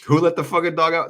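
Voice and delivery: high, loud rallying call; playful holler. Acted. Tarot reader hyping up the Pisces, playful high holler. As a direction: holler it out to hype the crowd.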